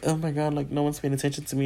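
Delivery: soft imitated grumble; left out. Coworker feeling ignored on her birthday, soft grumble. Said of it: "Her other coworker kinda felt like".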